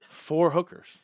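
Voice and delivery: loud, high, exasperated confession; incredulous outburst. Real, not acted. Storyteller confessing his night to friends, loudly incredulous. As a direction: burst out in disbelief at yourself.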